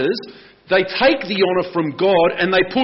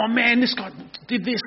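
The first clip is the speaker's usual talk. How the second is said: grateful retelling; 7 semitones higher, 4 dB quieter than usual. Retold or imitated. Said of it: "You're gonna go".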